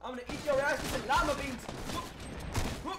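battle cry. Real, not acted. Battle cry while attacking.

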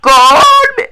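high, loud disbelieving squeal; sudden high yelp of disbelief; shocked shriek. Real, not acted. Shocked at a goal chance.

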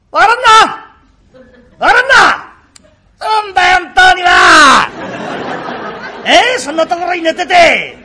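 high, loud hectoring shouts; loud bullying. Acted. Kuma barging in and shouting at the sick young master. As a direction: bellow at him to snap out of it. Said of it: "大きな声を出しちゃ嫌だよ".

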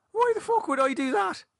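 very high-pitched outraged question; affronted, voice shooting up; indignant. A narrator's reading. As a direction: squawk in offended disbelief. Indignant at being suspected of talking.

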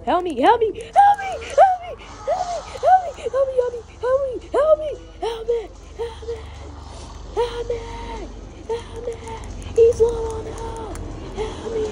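high-pitched desperate pleading; frantic high cries for help. Real, not acted. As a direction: yelp for help, voice cracking high. Panicked calls for help in game.

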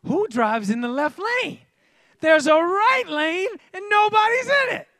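high, loud road-rage outburst; frustrated ranting. Retold or imitated. Driver stuck behind a truck, ranting in rising frustration. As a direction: climb into indignant, incredulous yelling. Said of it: "That doesn't frustrate y'all?".

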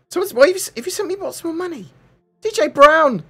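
high-pitched shocked squeal; surprised. Real, not acted. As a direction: caught off guard, voice jumps high. Donor sent much more money.

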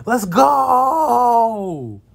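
full-voice victory yell; triumphant shout. Real, not acted. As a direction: shout it across the pond. Celebrating the fish.

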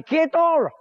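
hammed-up "Quieto!"; mock shout. Saint stops the motorbike. (grito de broma)

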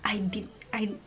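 breathy whisper fighting off tears; choked back tears. Retold or imitated. Visa applicant fighting tears at the embassy, whispering. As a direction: hold back crying, barely voiced. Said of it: "I stopped myself from crying".

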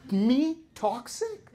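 high, loud indignant yelp; mock outrage. Real, not acted. Reacting to the toxic-people jab, loud high mock indignation. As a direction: act scandalised at the word. Shocked at being called toxic.

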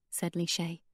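ordinary delivery

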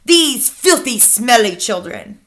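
slow, loud witch's tirade, dropping at the end; screamed. A narrator's reading. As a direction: rage it out slowly, then fall away. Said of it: "she screamed".

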